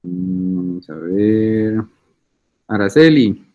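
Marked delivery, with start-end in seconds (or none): none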